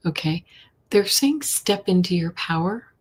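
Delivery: normal talking voice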